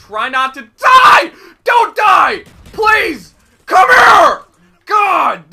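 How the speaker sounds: panicked gamer yell, slow drawn out; frantic yelling. Real, not acted. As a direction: shout it, high and loud. Frantic gameplay, yelling at screen.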